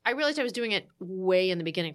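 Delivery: ordinary talk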